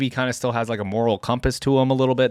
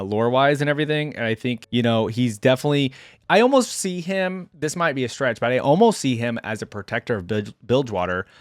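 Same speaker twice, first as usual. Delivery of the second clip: ordinary delivery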